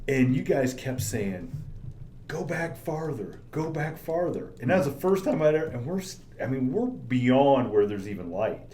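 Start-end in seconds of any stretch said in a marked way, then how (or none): 2.3-4.5 higher, softer, drawn-out imitation; soft, high mock instruction; crew giving directions. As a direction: slowly imitate a director waving them back. Quoting crew directing them backwards.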